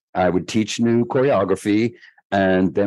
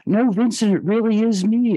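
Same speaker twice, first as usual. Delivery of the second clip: much higher put-on voice of the caller; a shy superstar caller voiced far higher; 12 semitones higher than usual. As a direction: pitch way up to play the famous caller on the phone. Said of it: "he goes".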